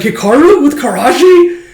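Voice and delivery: high, loud astonished question; mock incredulous. Character reacting to the pairing, mock incredulous. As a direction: imitate loud disbelief, pitched well up.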